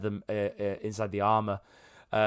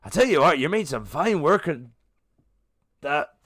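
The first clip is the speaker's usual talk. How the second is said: high, loud hearty praise; hearty king praise; 5 semitones higher, 5 dB louder than usual. Acted. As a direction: boom out praise like a proud king. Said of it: "Nodamir turns around and goes".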